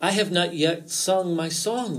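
mild cautionary tone; understated fox's warning. A narrator's reading. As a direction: warn the rabbit, pitch lifting. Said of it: "warned Fox".

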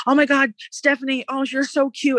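mock the lovestruck kid; lightly voiced smitten boy. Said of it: "and he's like".

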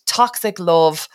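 usual speaking voice

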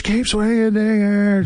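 high, stretched-out cheer; imitated bench yell. Fleury on the bench urging on a fighting teammate, imitated yell. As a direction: call it out like a teammate across the rink.

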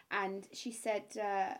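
ordinary talk, nothing marked

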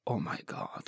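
whispered aside. Retold or imitated. Aside about crying captor.

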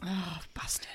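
whisper. Real, not acted. Mock-outraged whisper.

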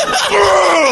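high grunting shout as the tooth goes in; a short hammed-up stabbing grunt; mock monster grunt. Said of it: "and goes".

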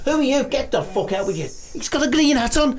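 high, frantic indignation; outraged diva rant. Acted. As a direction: work yourself into a superstitious fury. Diva rants at intruder's green hat.

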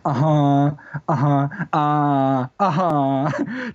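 long, slow drawn-out uh-huhs; drawly Moray nodding. Shown as a demonstration. Showing the host how Moray listeners nod along, drawn out. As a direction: stretch each uh-huh out like a patient listener. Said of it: "drawly answers".

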